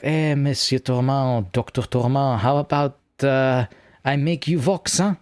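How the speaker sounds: over-the-top mock French accent; [name], ridiculous French accent. Artist offering to make the streamer into Vox, in a ridiculous French accent. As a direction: imitate a French artist, accent laid on thick. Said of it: "I'm going to put on a ridiculous French accent".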